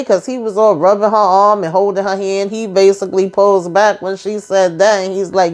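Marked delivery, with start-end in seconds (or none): none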